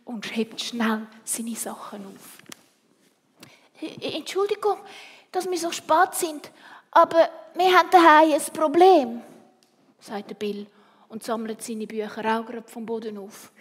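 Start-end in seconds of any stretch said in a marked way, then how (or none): 4.0-9.2 high-pitched apology, rising in volume; a flustered child excusing himself; flustered schoolboy. A narrator's reading. Bill apologises to the teacher for being late, flustered.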